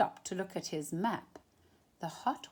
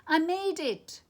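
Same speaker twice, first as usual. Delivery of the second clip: higher and louder joyful cry; cry of triumph; 10 semitones higher, 5 dB louder than usual. Acted. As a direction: shout it like you've finally arrived. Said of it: "cried Pablo".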